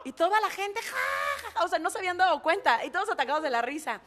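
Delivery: ha ha through the story; laughing. Real, not acted. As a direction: tell it while cracking up. Audience laughing at slip.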